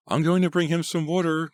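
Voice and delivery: put-on high called-out girl's voice; calling out. A narrator's reading. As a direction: call across the room. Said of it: "Greta called".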